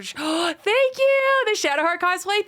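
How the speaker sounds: high gasp then thank you. Real, not acted. Streamer gasping at a viewer's cosplay compliment, surprised. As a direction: gasp with real delight and thank them.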